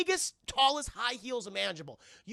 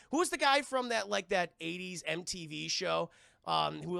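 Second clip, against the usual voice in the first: ordinary talk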